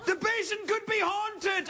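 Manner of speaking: high, shouted, drawn-out alarm; hammed-up fear of a haunted bowl; mock terror. Frightened friend refusing to return.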